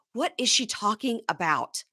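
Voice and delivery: ordinary talk, nothing marked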